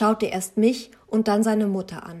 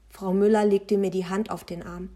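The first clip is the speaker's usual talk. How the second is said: ordinary delivery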